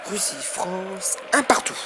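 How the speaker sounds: loud, lively match wrap-up; excited sports commentary. Real, not acted. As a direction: keep the crowd fired up. (commentaire sportif enflammé)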